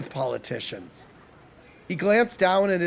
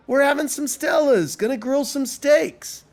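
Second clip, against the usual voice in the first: higher, upbeat offer; cheery, pitched-up invitation; breezy invitation; 7 semitones higher than usual. A narrator's reading. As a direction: tempt them to join in. Eric invites devil to join them.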